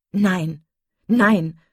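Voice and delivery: loud, vehement contradiction; impetuous. A narrator's reading. Marian contradicts her sister, impetuous. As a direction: burst out in protest. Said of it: "rief Marian ungestüm".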